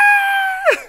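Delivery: very high, loud shriek; shrill scream. Retold or imitated. Marlon Wayans screaming, grabbed by the woman. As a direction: let out a piercing shriek.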